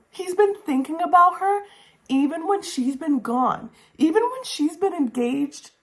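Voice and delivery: high-pitched swooning gush; excited gushing. Real, not acted. Reviewer swooning over the hero's devotion, high gush. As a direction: swoon over every word. Gushing about love interest.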